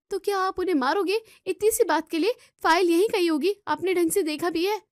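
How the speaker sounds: high, loud furious protest; angry shouting. Acted. Sister defending her sister-in-law against her brother, angry shout. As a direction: shout him down in anger.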